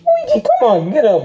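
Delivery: loud, high urging; impatient shouting. Acted. Mario waking a passed-out Luigi, loud and impatient. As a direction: shout to rouse someone out cold. Rousing an unconscious brother.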